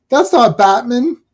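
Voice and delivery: "Batman" with a reduced second syllable, like "BAT-mun"; Batman as a surname. Retold or imitated. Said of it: "the parents kept saying Batman".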